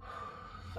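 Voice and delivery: long audible breath, imitated; soft, drawn-out breath; deep breath. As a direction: demonstrate breathing in the gas. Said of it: "three or four deep breaths".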